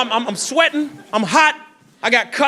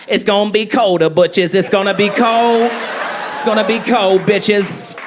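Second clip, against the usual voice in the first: Katt Williams impression; 3 semitones lower than usual. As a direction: deliver it like Katt Williams predicting winter. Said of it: "Cat Williams called me up".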